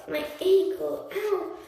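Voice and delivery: loud, high fake pain wail. A narrator's reading. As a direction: ham up a sprained ankle. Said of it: "started to wail".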